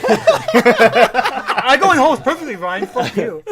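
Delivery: loud, high-pitched comic protest; playfully outraged comeback, half laughing; mock indignation. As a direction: protest loudly through laughter at the teasing.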